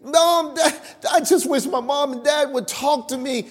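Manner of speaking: put-on high, begging child voice; child begging parents to talk to them, high and pleading. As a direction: plead like a neglected kid. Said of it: "plead and beg".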